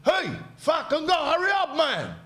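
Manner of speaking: hammed-up rude customer shouting to hurry; impatient customers. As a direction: rude customer mocking the Santa staff, high put-on voice. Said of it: "sitting there going".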